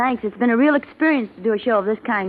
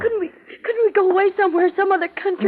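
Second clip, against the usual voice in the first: high, trembling plea to flee; frightened pleading; 8 semitones higher than usual. Acted. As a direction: beg him, terrified, to run away. Wife panics as police arrive.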